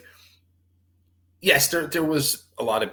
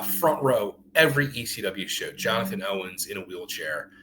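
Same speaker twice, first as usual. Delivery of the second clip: normal talking voice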